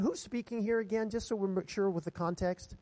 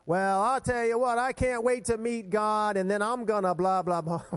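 cocky churchgoer; 2 semitones higher, 5 dB louder than usual. Acted. Pastor mimics someone eager to meet God, mock-boastful. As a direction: rattle it off with hammed-up eagerness. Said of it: "you ever heard someone say".

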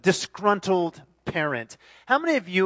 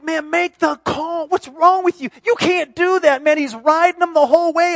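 much higher, louder heckling; mock ref rant; 10 semitones higher, 7 dB louder than usual. Disgruntled dad heckling the referee, angry. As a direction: berate the referee. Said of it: "the refs was like".

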